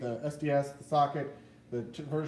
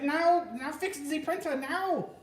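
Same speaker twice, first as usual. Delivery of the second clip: higher, slow, bossy put-on German accent; mock German accent; 9 semitones higher, slower than usual. Wife insisting he fix the printer now, slow mock German accent. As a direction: bark the order like a mock German drill sergeant. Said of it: "You know, I'm German heritage".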